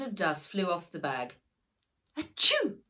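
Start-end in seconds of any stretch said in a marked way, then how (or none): 2.2-2.8 loud high fake sneeze; a big squeaky dog sneeze; sharp mock achoo. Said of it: "sneezed Haggis".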